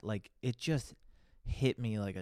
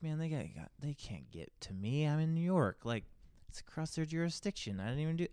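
cocky, raised pitch brush-off; retold cocky; 6 semitones higher than usual. Cocky to the officer on the phone, retold, brush-off. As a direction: shrug off the police like you're untouchable. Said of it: "I am so cocky on the phone".

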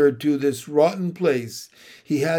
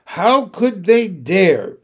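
louder, slow outraged question; indignant outrage; 4 semitones higher, 7 dB louder, slower than usual. A narrator's reading. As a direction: let the outrage burst out. Narrated angry thought.

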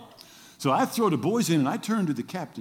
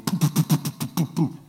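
motor sputter. Retold or imitated.

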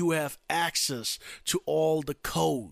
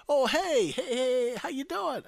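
high, overeager fake-friendly hello; pretend happy greeting; 11 semitones higher, 4 dB louder than usual. Outgoing president calling the winner, high-pitched fake cheer. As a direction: gush with put-on delight. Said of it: "has to call you and pretend".

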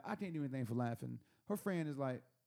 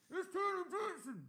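muffled visitor voice. Retold or imitated. Visitor answering through the door, high distant-sounding call. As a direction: imitate someone calling high from behind a door.

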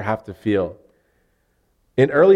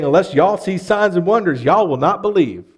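Southern accent; 6 semitones higher, 4 dB louder than usual. Shown as a demonstration. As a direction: say it like a Southern Arkansan, leaning on y'all. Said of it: "He didn't say it in that accent".

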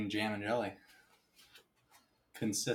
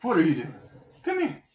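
squeaky voice for the cat; high cat-calling voice; 4 dB louder than usual. Real, not acted.

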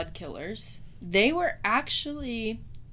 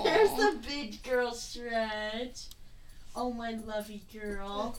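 slow, drawn-out fond coo to a dog; doting dog-owner voice; cooing pet talk; 2 semitones higher, much slower than usual. Real, not acted. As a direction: coo lovingly, stretching out the words.

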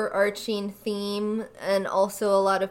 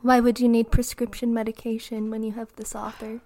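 fast breathy ASMR whisper; 3 dB louder, faster than usual. Pretend. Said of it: "This is not A S M R time".

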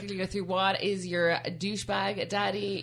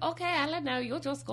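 higher, lightly voiced doctor impression; imitating the doctor; 9 semitones higher than usual. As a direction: do the doctor in a raised voice. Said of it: "He's like".

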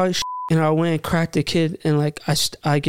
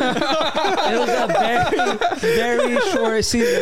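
laughs through the words; giggling while admitting it; laughing; 10 semitones higher, slower than usual. Real, not acted. Laughing at his own short rugby season.

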